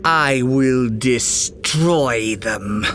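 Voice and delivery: slow, menacing vow of revenge; fuming threat. A narrator's reading. Furious queen vows to destroy the pirates, slow seething threat. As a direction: seethe each word out slowly. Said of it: "and fumed".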